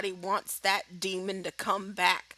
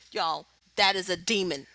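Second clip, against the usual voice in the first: ordinary talk, nothing marked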